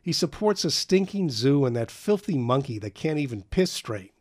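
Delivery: high, loud, scornful tirade; a boy mocking his father to friends; agitated bitter rant. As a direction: spit out contempt for his father. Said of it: "taking the dim and agitated view".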